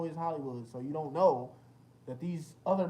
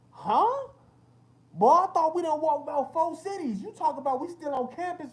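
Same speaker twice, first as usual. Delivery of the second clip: high, loud, mock-outraged retelling; loud disbelief; 9 semitones higher, 9 dB louder than usual. Telling friends about the endless campus walk, loud mock disbelief. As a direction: bellow it like you can't believe the walk.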